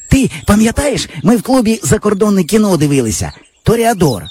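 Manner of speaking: put-on boyish friend. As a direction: brag like a cocky village boy. Java recalls the film.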